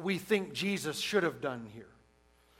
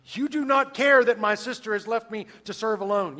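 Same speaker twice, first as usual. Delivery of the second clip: raised, loud, indignant complaint; indignant shout; 5 semitones higher, 8 dB louder than usual. Retold or imitated. Martha to Jesus, complaining she serves alone: indignant shout. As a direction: Martha scolds the Lord, voice raised high. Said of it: "it's the same tone".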